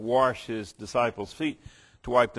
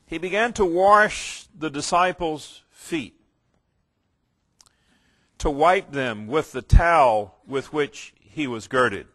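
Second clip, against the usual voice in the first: raised, measured Bible reading to the congregation; scripture reading; 4 semitones higher, 7 dB louder than usual. Acted. Preacher reading John to his listeners, solemn and raised. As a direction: proclaim the text with weight.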